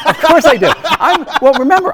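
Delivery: a delighted laughing "of course"; amused laugh. Real, not acted. Amused reply about age.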